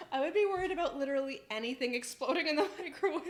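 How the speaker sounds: talking through a laugh. Real, not acted. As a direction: say it while cracking up. Laughing at chat's explosion worry.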